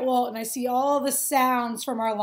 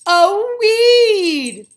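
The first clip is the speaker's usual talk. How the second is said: mocking jeer; 7 semitones higher, 12 dB louder than usual. Characters mocking the dandelion.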